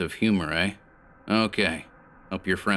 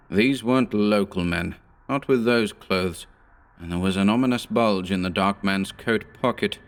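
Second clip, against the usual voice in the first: ordinary delivery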